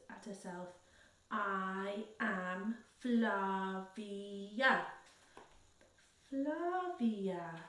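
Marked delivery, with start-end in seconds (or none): 1.3-4.9 extremely slow, word by word; each syllable stretched and separate; slow teaching. A narrator's reading. Slowly teaching her name to non-speaker. 6.3-7.6 "Flavia" drawn out and tentative, falling at the end; hesitant, slow copy of "Flavia"; haltingly. A narrator's reading. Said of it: "replied Nubia haltingly".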